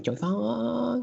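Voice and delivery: high, sing-out mock Chinese accent. Host unloading equipment from car, mock Chinese accent. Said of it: "you have to say it in an".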